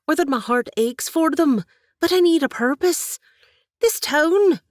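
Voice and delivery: high, loud impassioned plea; burn with purpose; fervent plea. Acted. Katie tells her aunt she needs a purpose, fervent and loud.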